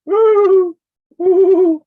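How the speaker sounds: loud falsetto owl hoots; long, high "woo", twice; hoot owl impression. Shown as a demonstration. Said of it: "Let's try to sound like a ho-, hoot owl".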